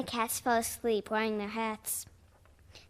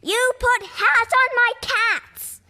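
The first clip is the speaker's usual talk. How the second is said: loud, high, slow furious accusation; enraged captain roaring; bellowed; 12 semitones higher, 8 dB louder, slower than usual. Acted. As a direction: bellow it at Scurvy. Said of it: "Captain Blackbeard yelled".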